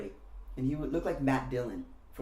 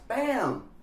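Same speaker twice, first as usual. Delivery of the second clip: high, loud, explosive hit; punch sound effect; 11 semitones higher, 5 dB louder than usual. Retold or imitated. Telling how the uppercut landed, explosive shout.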